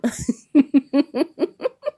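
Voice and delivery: high, loud burst of giggles; laughing. Real, not acted. Amused by the character she describes.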